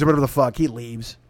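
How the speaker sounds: usual speaking voice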